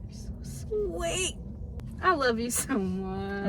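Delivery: tender. Real, not acted. Tender slow affection.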